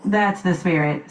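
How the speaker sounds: usual speaking voice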